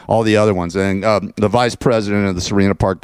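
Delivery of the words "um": ordinary talk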